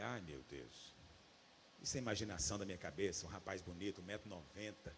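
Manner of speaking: very low, hushed aside to himself; murmured inner thought. Retold or imitated. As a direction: voice the private doubt under your breath. Retelling his doubtful inner thought.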